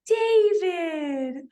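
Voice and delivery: gasp, then a high, loud cry; real surprised shout with the name drawn out; sudden high-pitched call after a sharp gasp; startled exclamation. Real, not acted. Speaker suddenly exclaims someone's name.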